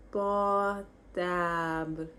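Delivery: "Portable" stretched out very slowly, syllable by syllable. Shown as a demonstration. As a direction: draw out "Portable" for learners. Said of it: "how to say phone".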